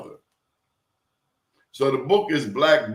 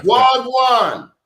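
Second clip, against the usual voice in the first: loud, high Jamaican wagwan; Jamaican accent; 10 semitones higher, 8 dB louder than usual. Pretend. Defiantly greeting the host in Jamaican accent. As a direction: belt it out in Jamaican patois. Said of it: "my Jamaican accent".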